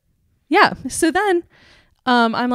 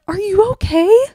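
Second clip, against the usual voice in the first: high, rising worried question; exaggerated concern; 10 semitones higher, 4 dB louder than usual. Girl coming up to her crying, exaggerated high concern. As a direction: ask with hammed-up concern, pitched way up.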